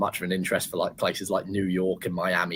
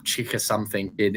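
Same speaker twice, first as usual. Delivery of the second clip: ordinary delivery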